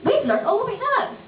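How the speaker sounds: high mock son; son's voice. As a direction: pipe up like a proud kid. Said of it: "And I went".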